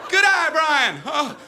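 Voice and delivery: loud, high cheer and thanks; rattled shouting. Acted. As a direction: call it out high, still shaken from the near miss. Cheer and rattled batter's reply.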